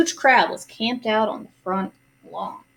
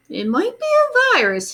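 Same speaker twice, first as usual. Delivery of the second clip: high, louder announcing doctor; doctor's grand announcement, raised high; announced; 10 semitones higher, 4 dB louder than usual. A narrator's reading. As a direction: proclaim it like a puzzled expert. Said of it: "he announced".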